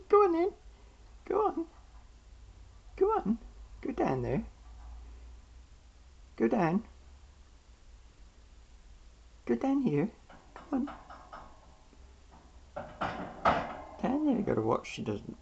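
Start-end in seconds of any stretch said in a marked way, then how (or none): 0.1-3.4 high coaxing squeak. Real, not acted. Coaxing pet bird. 6.4-14.4 high coaxing pet talk. Real, not acted.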